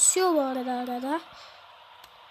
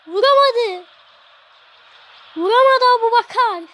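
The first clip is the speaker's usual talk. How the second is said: frustrated groan; 7 semitones higher, 9 dB louder than usual. Real, not acted. Missed chance, loud groan.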